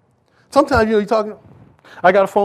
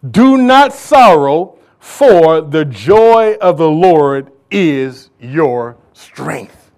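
preaching at full force; 9 dB louder, much slower than usual. Acted. Preacher proclaiming the verse to the church, slow and booming. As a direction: drag out every word of the promise. Climax of the scripture reading.

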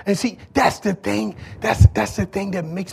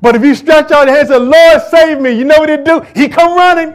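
higher, louder preaching; full-force preaching; 7 semitones higher, 9 dB louder than usual. Acted. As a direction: drive it home to the church.

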